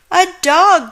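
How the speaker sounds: high, loud shocked gasp. Acted. Manager discovering the dog in the cart, high gasping cry. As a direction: recoil at the sight of the dog. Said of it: "gasped the manager".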